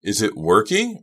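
high, loud, hopeful question; eager cry. A narrator's reading. Asking Lou whether the phone works, eager. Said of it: "I cried, jumping up off the couch eagerly".

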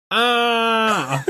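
high squeal of delight; excited squeal. Retold or imitated. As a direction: squeal like a thrilled fan. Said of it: "So I'm freaking out".

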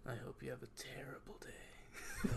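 slow, soft spooky whisper; mock-creepy whisper. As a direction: whisper a playful curse to the listeners.

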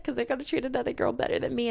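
mock self-pity. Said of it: "And I was like".

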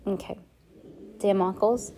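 ordinary delivery, nothing marked

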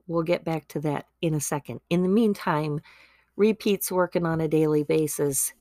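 ordinary talk, nothing marked

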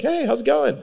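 high, bright-voiced customer hello; high friendly customer voice; cheery customer greeting. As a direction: greet him like a chipper customer.

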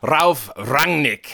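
"Ralf Rangnick" very slow, German attempted; amateur German pronunciation. Shown as a demonstration. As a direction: try "Ralf Rangnick" slowly for the judge. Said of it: "how bad our pronunciations".

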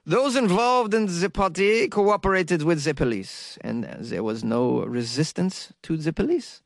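slow, badly missed French accent; botched French accent. Shown as a demonstration. Podcaster quoting French police in a botched French accent. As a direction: attempt a French accent and miss. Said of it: "a French accent, though. I screwed that up".

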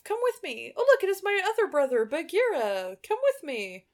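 put-on high, quick, stilted boy voice; rapid, high-pitched naive kid; earnest boy Mowgli. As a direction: greet them like a stiff cartoon child. Voices Mowgli greeting his brothers.

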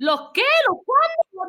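high acted bewilderment; baffled. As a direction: question it in disbelief, pitch climbing. Acts out baffled reply to mom.

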